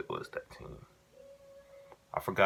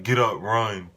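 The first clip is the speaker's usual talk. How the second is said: loud, higher mock coach command; coach barking orders; 4 semitones higher, 7 dB louder, slower than usual. Coach punishing the team, a loud barked command. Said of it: "Then he'd be like".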